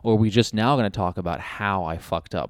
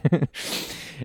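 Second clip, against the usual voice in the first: breathy laugh; 5 semitones higher, 4 dB quieter than usual. Real, not acted. Laughs at own joke.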